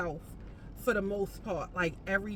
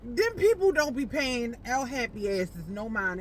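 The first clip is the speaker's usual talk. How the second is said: ordinary talk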